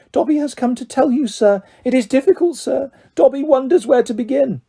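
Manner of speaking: high, fluttering elf voice; a small creature overjoyed to meet his hero; eager house elf. A narrator's reading. Elf meeting Harry Potter at last, high and eager.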